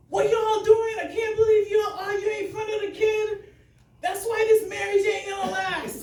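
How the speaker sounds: put-on loud, high Kittitian accent scolding; a Kittitian grandmother shouting at the couple. Kittitian grandmother shouts at arguing couple, loud high scolding. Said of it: "with her Kittitian accent".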